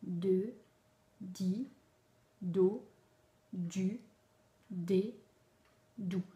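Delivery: each syllable spaced out with a pause; slow syllable drill for a child; reading syllables aloud. Shown as a demonstration. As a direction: model the syllables one by one, clearly. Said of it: "on va faire lire l'enfant".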